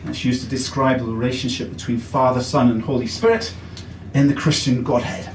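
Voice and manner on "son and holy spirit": ordinary talk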